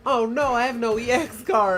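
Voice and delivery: mock dismay. Teasing his friend about lacking an EX card, mock whine. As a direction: ham up the kid's disappointment. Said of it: "and you're like".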